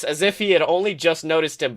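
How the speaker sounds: ordinary talk, nothing marked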